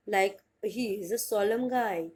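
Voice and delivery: drawn-out example sentence; slow example read. Shown as a demonstration. Teacher to students, slow example sentence. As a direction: read it very slowly for the class. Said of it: "example sentence read slowly".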